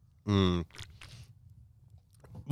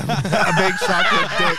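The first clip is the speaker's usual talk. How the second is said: slow drawn-out; 9 semitones higher, slower than usual. Real, not acted. Joking guess repeated.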